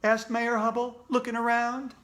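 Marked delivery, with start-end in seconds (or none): none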